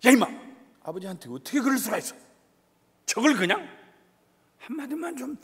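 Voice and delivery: high-pitched acted exasperation; upset, angry father. Father wishing his wife would back him against son, exasperated. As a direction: complain heatedly, bursting out at the son. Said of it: "아버지가 막 속상하고 화나고 해서".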